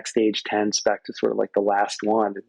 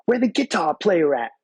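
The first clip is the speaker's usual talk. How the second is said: a lightly voiced, raised soul-singer growl calling out; 6 semitones higher than usual. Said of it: "the voice of [name] just comes out".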